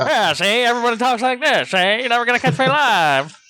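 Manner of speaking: put-on high-pitched old-movie Mid-Atlantic parody; mock a thirties film actor's Mid-Atlantic accent; hammed-up, high Mid-Atlantic gangster patter. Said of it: "that Mid-Atlantic or whatever accent".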